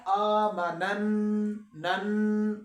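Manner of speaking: آمنن with the nn held in the nose; nasal ghunnah. Shown as a demonstration. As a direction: hum the نن through the nose, slowly. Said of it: "آواز کو ناک میں لے".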